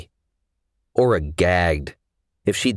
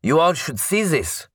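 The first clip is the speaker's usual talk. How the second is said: raised, high call to the others; gruff call; 10 semitones higher than usual. A narrator's reading. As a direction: call them over, a touch gruff. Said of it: "his voice gruff".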